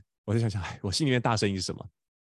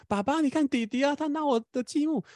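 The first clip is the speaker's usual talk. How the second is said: put-on much higher little-boy voice tattling to dad; imitating his four-year-old son. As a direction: play the small son complaining about his brother, pitched high. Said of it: "他是跟我讲说".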